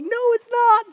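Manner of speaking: very high, loud squeal of contradiction; mock outrage. Girlfriend flatly contradicting boyfriend, high-pitched mock outrage. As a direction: shoot it down in a squeaky shout.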